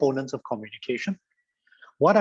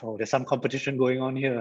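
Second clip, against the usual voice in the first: ordinary talk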